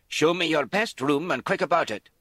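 loud, high, barking order; commanding shout. Acted. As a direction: snap the demand loud and high. Lizzie orders the innkeeper.

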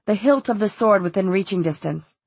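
ordinary delivery

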